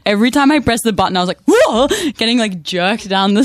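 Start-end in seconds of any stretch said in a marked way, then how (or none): 1.4-1.9 high, louder mock whoa; hammed-up cry of alarm; startled yelp. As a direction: act out being jolted forward. Reenacting being jerked by scooter.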